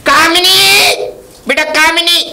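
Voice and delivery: high, loud, drawn-out name call. Acted. Elder at the girl's door, calling out for her. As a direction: call her out from inside the house.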